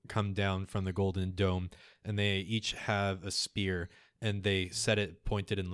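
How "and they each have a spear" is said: normal talking voice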